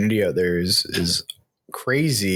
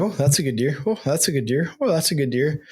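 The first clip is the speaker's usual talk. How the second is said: higher, eager repeated exclamation; excited muttering; 5 semitones higher than usual. Retold or imitated. Hunter spotting a buck through binoculars, excitedly. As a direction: keep saying it, getting hooked on the deer.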